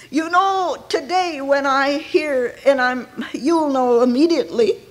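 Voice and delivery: ordinary talk, nothing marked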